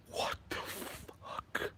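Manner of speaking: slow, breathless whispered disbelief; hushed stunned whisper; shocked whisper. Retold or imitated. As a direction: whisper it like you can't believe your eyes. Shocked kid reacting to Santa.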